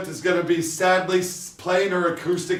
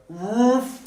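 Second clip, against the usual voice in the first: dog woof; 3 dB quieter than usual. Retold or imitated. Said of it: "He looks at the camera and goes".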